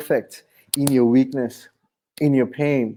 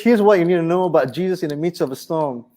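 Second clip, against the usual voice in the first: normal talking voice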